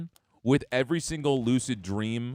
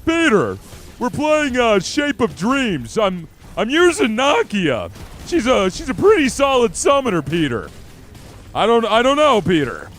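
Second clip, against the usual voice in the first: loud, higher put-on cartoon neighbor voice, dragging out Peter; Joe Swanson voice; 7 semitones higher, 7 dB louder than usual. Streamer showing chat his go-to impression, loud and higher. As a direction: bellow it out like the wheelchair cop calling his buddy Peter. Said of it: "I just go straight into Joe Swanson".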